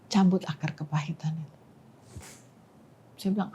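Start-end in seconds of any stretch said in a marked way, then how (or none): 0.1-1.6 soft, low-key voice from above; retold voice of God. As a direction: say it quietly, as a voice heard in prayer. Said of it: "dengar suara". (suara Tuhan yang pelan)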